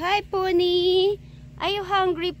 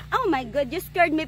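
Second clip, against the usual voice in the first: ordinary delivery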